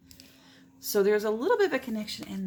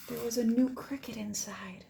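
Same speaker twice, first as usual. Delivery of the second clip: softer hushed reveal; whisper; 3 semitones higher, 3 dB quieter than usual. A narrator's reading. Teacher revealing the new cricket to kids, whispered. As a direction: whisper the happy surprise.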